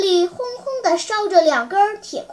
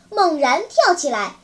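usual speaking voice

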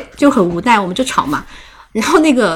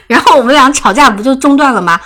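usual speaking voice

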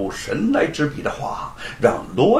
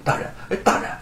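uneasy; 5 semitones higher, much faster than usual. A narrator's reading. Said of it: "罗万金不安地说".

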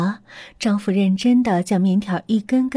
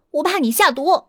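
accusing; 7 semitones higher than usual. Acted. Wife accuses husband.

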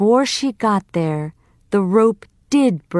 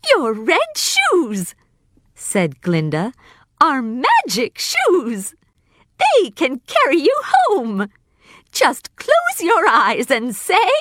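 high, light fairy-witch voice; good witch; 9 semitones higher than usual. Acted. Glinda greeting Dorothy, high and gentle. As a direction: float it up high and kind.

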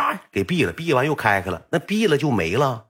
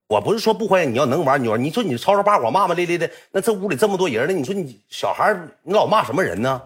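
put-on scolding old man; 7 semitones higher than usual. Old owner scolds drunk Yong.

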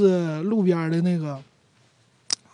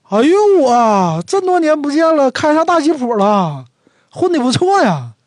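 surprised greeting; 8 semitones higher, 6 dB louder than usual. Acted. Meeting a buddy after years, amazed he drives a jeep, high and loud. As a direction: stretch out the delighted "哎呦我", then burst high and loud. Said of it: "好几年的哥们儿没见面了".

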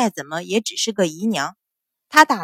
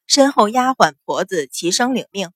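usual speaking voice

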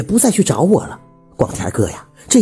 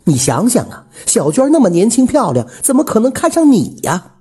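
high-pitched, vehement warning; resentful; 8 semitones higher than usual. A narrator's reading. Widow warning him off her rival, resentfully. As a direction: spit out the grudge, then press him. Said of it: "虎子妈喘着粗气，狠狠地说".

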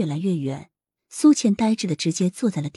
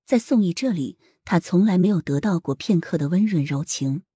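ordinary delivery, nothing marked

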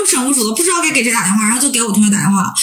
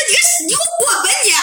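retold furious yell; 12 semitones higher, much faster than usual. Student cursing out the scammer on the phone, furious shout. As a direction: tell him to get lost at full force. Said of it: "我都骂他了".

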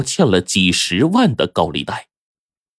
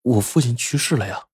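halting, softer start as if caught off guard; startled stammer; 2 semitones higher, 5 dB quieter than usual. A narrator's reading. Young man asked about his father, startled and faltering. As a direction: stumble over the first words, taken aback. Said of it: "这年轻人一惊".

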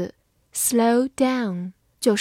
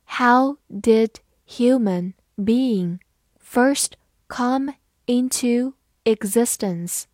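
slow, careful read-aloud; slow classroom model reading; slower than usual. Shown as a demonstration. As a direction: read it out word by word. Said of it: "teacher reads the sentence slowly".

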